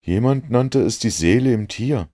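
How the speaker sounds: a hint of an eager companion; Watson's voice. A narrator's reading.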